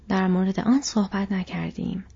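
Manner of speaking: ordinary delivery, nothing marked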